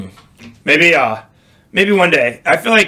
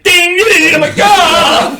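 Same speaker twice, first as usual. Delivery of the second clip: imitated ding alarm; 6 dB louder, slower than usual. Imitating truck alert and scream.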